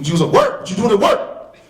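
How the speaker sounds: high, loud incredulous jab; imitating the sergeant scoffing at the mother; scornful sergeant. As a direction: sneer at her excuse.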